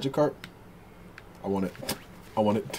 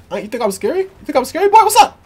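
mock taunt; 8 semitones higher, faster than usual. Gamer taunting a wild monster, playful mock challenge.

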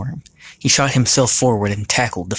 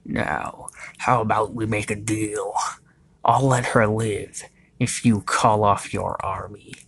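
hushed, slow villain offer; sly villain bargaining. Acted.

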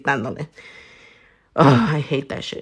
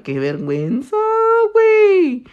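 embarrassed outburst; 14 semitones higher, 5 dB louder than usual. Retold or imitated. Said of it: "I'm like".

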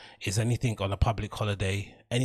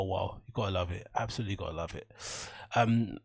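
ordinary talk, nothing marked

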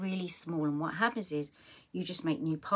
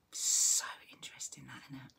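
whisper; 4 semitones lower, 3 dB quieter than usual. Real, not acted. Musing aside.

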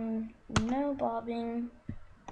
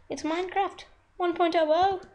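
thrilled shout; 9 semitones higher, 7 dB louder than usual. Real, not acted. Thrilled about new version.

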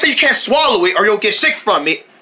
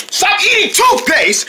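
scolding yell; 10 semitones higher than usual. Acted. Friend yelling at the toothpaste eater. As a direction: snap at him to stop.

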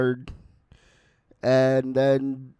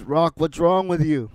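louder retold scolding of Rock, a touch raised; mock angry Apollo; 3 semitones higher, 6 dB louder than usual. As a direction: coach snapping at his lazy fighter, mildly. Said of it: "which makes Apollo very angry".

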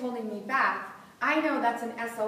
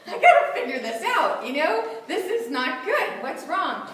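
laughing frustration; 6 dB louder than usual. Retold or imitated. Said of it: "And I'm like".

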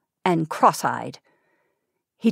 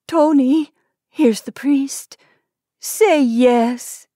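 higher, louder desperate plea; weak pleading; 5 semitones higher, 5 dB louder than usual. Acted. Wife pleading to be made a wife before he dies, desperate. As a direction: plead with him, voice straining. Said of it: "the woman's weak pleadings continued".

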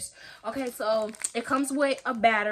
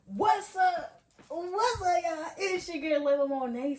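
higher, bright host greeting; vlog intro; 7 semitones higher than usual. Acted. Starting her video for viewers, host greeting.

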